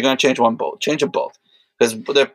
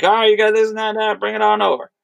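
higher, lightly voiced mechanic on the phone; imitating mechanic Tim; 10 semitones higher, faster than usual. Retelling the mechanic's phone invite, lightly mimicking him. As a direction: toss it off like a casual mechanic inviting him over.

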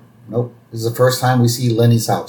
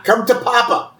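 high-pitched mock gloat; 10 semitones higher than usual. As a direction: crow over your winnings. Moe gloats collecting chips.

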